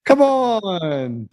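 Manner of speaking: high-pitched put-on mob boss; mobster accent. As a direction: order the henchman about like a movie gangster. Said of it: "the best accent I've heard you do".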